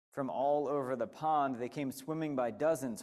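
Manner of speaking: ordinary delivery, nothing marked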